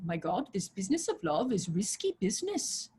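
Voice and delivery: imitated Punjabi-accented English; a hint of Punjabi accent. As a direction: quote her in a light Punjabi accent. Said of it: "in her beautiful Punjabi accent".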